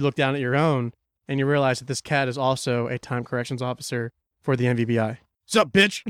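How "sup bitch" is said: cocky. Acted. Cat cop greets players.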